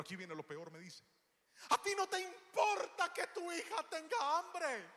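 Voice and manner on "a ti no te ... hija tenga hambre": put-on child accusing. Daughter guilting her father.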